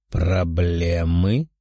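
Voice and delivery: low, slow, scornful demon voice; dismissive demon. A narrator's reading. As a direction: drawl it down at him with contempt. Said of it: "пренебрежительный взгляд спросил Тео".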